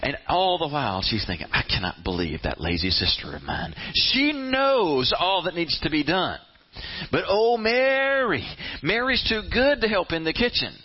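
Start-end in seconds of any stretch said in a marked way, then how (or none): 1.5-3.7 low seething inner grumble. Acted. Martha privately fuming about her lazy sister, seething low. 4.0-10.7 high, aggrieved martyr's rant; exasperated martyr. Acted. Martha stewing over Mary while she cooks, exasperated martyr rant. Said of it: "Martha's having a real good time".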